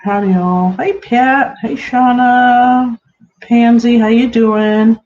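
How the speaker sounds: high, slow sing-song hellos to the chat; lilting high-pitched welcome; bright greeting. Real, not acted. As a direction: stretch out each hello to the viewers. Greeting viewers on live stream.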